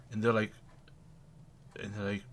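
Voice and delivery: usual speaking voice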